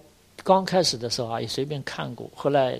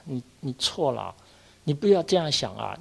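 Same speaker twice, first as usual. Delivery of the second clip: ordinary talk, nothing marked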